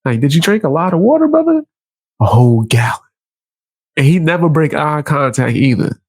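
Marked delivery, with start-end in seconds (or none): 0.2-1.6 quick question climbing very high at the end; mock questioning. Acted question to the man. 2.2-3.0 lightly voiced low reply; imagined man's answer.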